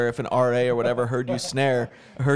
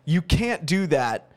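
ordinary talk

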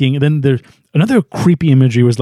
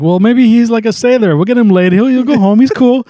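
raised, higher-pitched rationalizing; hopeful heroes; 8 semitones higher, 4 dB louder than usual. Retold or imitated. The team excusing the giant walking downtown, jokily hopeful. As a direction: talk yourself into optimism in a lifted voice. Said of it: "and they're like".

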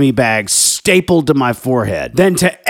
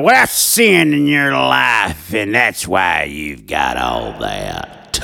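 mocking a judgy believer; 11 semitones lower, slower than usual. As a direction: sneer it out like a self-righteous church critic. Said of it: "they'll be like".

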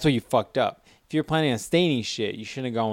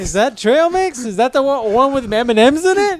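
high, excited squeal; mock excited; 9 semitones higher, 5 dB louder than usual. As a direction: squeal it like a thrilled kid. Pretend tasting, mock excitement.

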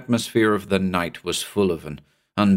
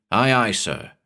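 ordinary delivery, nothing marked

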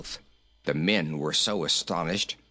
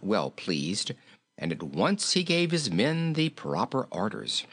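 ordinary delivery

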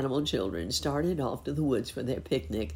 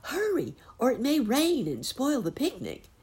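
puppy voice; 12 semitones higher, 4 dB louder than usual. A narrator's reading. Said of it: "added Petey".

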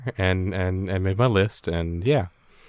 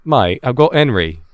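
louder mock British accent; [name], British accent; 3 semitones higher, 6 dB louder than usual. Defending the impression to co-host, loud mock British accent. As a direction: push the British impression harder. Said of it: "I've been practicing my accent".

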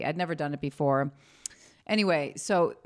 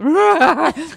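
high laugh; 12 semitones higher, 12 dB louder than usual. Real, not acted. Awkward laugh in therapy story.

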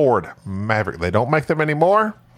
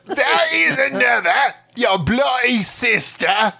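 put-on Aussie accent; 10 semitones higher, slower than usual. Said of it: "drinking Foster".

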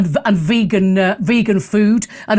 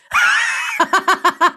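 high mocking cackle; scornful laugh; 8 semitones higher than usual. Host scoffing at a nuclear deterrent article, scornful laugh. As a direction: laugh in derision at an absurd idea.

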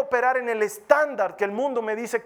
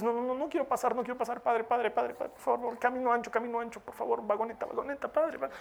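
put-on pleading higher like a begging child; whiny pleading child; 5 semitones higher than usual. Child begging father for the cart. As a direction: beg like a child who won't walk.

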